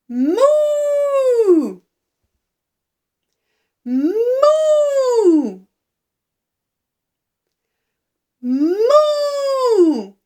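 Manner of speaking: high, loud, long-held moo; big cow moo. Shown as a demonstration. Teacher mooing loudly for babies to copy. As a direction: stretch out the moo like a happy cow. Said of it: "What sound does the cow make".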